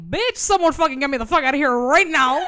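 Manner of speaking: loud, hammed-up panicked plea; mock panic. Bride at the aisle begging to escape, mock panicked yell. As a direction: yell for rescue, shooting up high on the last word. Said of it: "Instant panic attack".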